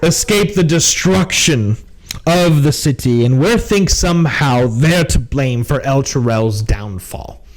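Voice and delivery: deliberate, pointed officer voice; suspicious captain. Acted. Captain blames the Hell Riders to party, suspicious. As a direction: lay out suspicions with weighty pauses.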